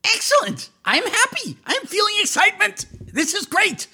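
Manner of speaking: high, anxious kobold king; Spazlatrash's voice. Acted. Spazlatrash asks the party about their mission, high and fretful. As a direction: pitch it up, fretful little ruler. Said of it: "DM voices Spazlatrash".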